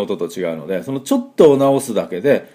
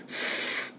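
long voiceless hiss of air over the lip; rubbing f sound; 16 dB quieter, much slower than usual. Shown as a demonstration. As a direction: blow an F like a grass whistle. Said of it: "っていう風に擦れる音を出してください". (草笛のように息を擦らせる音)

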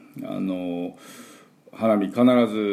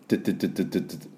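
rapid softer 「トゥトゥトゥ」 like a construction drill; drill-like tu sounds; 5 dB quieter, much faster than usual. Shown as a demonstration. Teacher imitates a drill for the D sound. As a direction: fire off short 「トゥ」 bursts fast. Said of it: "工事現場のドリルの音".

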